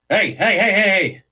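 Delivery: high, loud repeated hailing; calling out urgently. Acted. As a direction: flag the guards down as you run up. Running over, hailing the guards.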